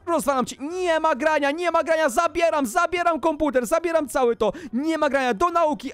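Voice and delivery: high, insistent parental telling-off; angry scolding. Acted. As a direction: lay down the punishment without a break. Parent bans games in scolding.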